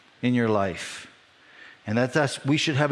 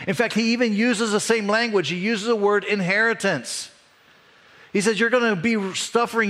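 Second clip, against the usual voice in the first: impassioned preaching; 9 semitones higher than usual. Acted. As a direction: preach it out with rising fire. Preaching builds to full force.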